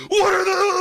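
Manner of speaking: high, loud, laughing cartoon gawker; mock leering creep. Cohost acts a creep staring at a chest, loud high laughing. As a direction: shout it like a goofy ogler.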